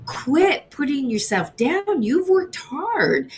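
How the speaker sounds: high, slow, insistent encouragement; daughter's firm pep talk. Retold or imitated. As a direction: lift the pitch and slow down to urge her.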